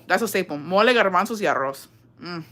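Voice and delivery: normal talking voice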